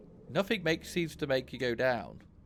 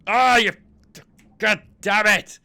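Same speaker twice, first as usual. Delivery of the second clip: much higher and louder, a real cry of rage; angry outburst; 12 semitones higher, 16 dB louder than usual. Real, not acted. Player cursing the game as it fails again, furious shout. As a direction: blow up at the screen.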